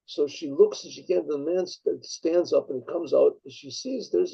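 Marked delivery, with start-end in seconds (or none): none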